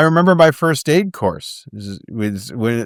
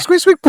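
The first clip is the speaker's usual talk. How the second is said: squeaky wheel noise; 14 semitones higher than usual. Retold or imitated. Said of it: "Turns her back".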